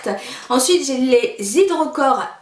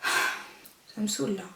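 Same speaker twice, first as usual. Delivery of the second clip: fed-up mutter; 3 semitones lower, 11 dB quieter, slower than usual. Real, not acted. Still stuck, annoyed.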